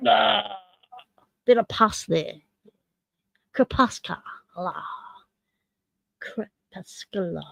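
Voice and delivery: soft Aussie take, trailing away. Shown as a demonstration. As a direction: say Crepuscular in a relaxed Australian accent. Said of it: "should just say it like an Aussie".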